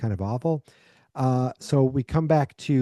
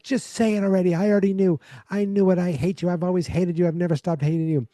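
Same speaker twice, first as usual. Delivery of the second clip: half-performed hateful outburst, pitched high; retold yelling; 7 semitones higher than usual. Mother yelling hatred at her captive son, imitated high yell. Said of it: "She's yelling".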